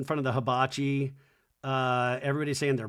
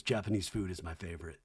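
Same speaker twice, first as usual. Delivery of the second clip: Jesse's voice; 7 semitones lower, 8 dB quieter, faster than usual. Retold or imitated. Jesse voiced low and soft.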